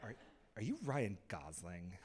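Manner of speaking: mock a fan in a soft, low voice; imitated girls' voice. Girl stopping to ask a star who he is, mock-awed and hushed. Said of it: "they had that voice".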